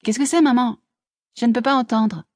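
put-on high girlish call to her mother; young Laura, questioning. A narrator's reading. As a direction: call back that you can't hear.

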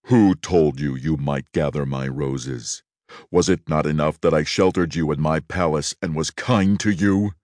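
low, menacing beast, slowing on the accusation; low furious monster demanding answers; terrible beast voice. Acted. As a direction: growl it out like an angry beast guarding his roses. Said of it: "said in a terrible voice".

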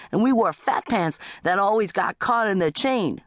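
high-pitched indignant rant; aggrieved teenager. Acted. Teenager resenting little brother's bicycle, high aggrieved rant. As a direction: complain like the hard-done-by older child.

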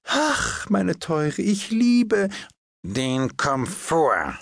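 a palmist gushing to his hostess. Acted. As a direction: swoon over comfort. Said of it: "sagte Herr Podgers".

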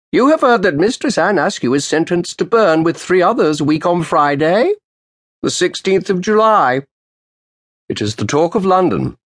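put-on old official's sharp greeting. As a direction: snap out a cold greeting to the lawyer. Roland's voice.

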